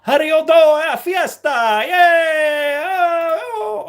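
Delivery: very high, loud cheering cartoonish voice; mock celebration. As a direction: whoop it up in a silly high voice.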